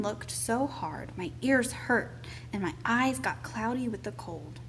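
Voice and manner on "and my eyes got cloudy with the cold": usual speaking voice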